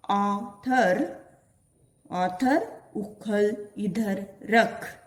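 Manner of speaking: each word spaced out slowly for the class; slow reading aloud. Shown as a demonstration. Teacher reading a sentence slowly to her pupils. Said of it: "इस प्रकार भी आप वाचन कर सकते हो".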